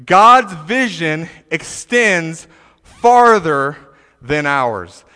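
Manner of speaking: slow, deliberate point; slow emphasis. Shown as a demonstration. Preacher stating his first point, slow and emphatic. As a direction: lay the point down word by word.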